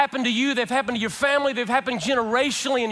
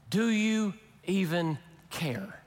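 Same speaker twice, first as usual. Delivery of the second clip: slow, much softer, wounded reproach; hurt accusing question; 4 semitones lower, 6 dB quieter, slower than usual. Retold or imitated. Disciples quietly reproaching Jesus in a slow, soft, hurt tone. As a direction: ask it like a betrayed friend. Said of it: "did you hear the tone that you asked that question in".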